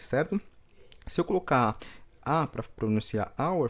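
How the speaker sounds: normal talking voice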